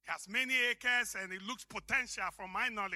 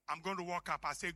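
normal talking voice

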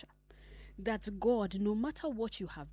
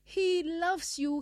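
higher, louder, slow and drawn out; emphatic preaching; 9 semitones higher, 8 dB louder, much slower than usual. Acted. Preacher assuring the fallen that God loves them. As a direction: stretch it out to reassure.

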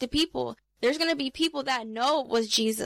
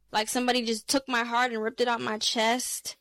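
ordinary talk, nothing marked

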